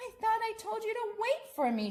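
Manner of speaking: put-on high, mildly reproachful little girl; mock scolding child. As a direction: gently scold like a small mother. Lisa scolding bear, voiced.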